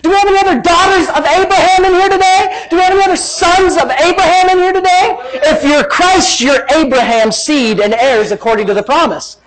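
high, impassioned sermon delivery; fired-up preacher at full voice; forceful preaching. Real, not acted. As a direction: drive each point home to the congregation.

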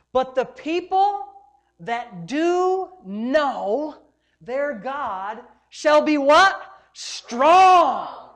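slow, loud scripture proclaimed; full-force preaching. Acted. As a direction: pastor declaring the verse to the congregation, slow and loud.

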